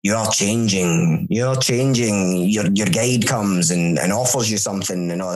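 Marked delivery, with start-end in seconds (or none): 0.0-2.5 very slow, drawn-out hypnotic suggestion; hypnotist impression. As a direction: stretch each word like a hypnotist. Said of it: "talks to you and he's like".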